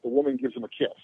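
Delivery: ordinary talk, nothing marked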